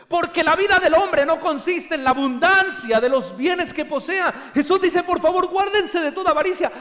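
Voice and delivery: loud, high preaching to the congregation; full-force preaching. Acted. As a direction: proclaim it with full fervour. Preacher quoting Jesus, exhorting.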